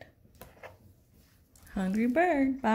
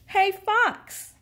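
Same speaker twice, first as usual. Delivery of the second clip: high-pitched call to a friend; 10 semitones higher, 4 dB louder than usual. A narrator's reading. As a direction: call out brightly to get Fox's attention. Hungry Bird calls out to Fox.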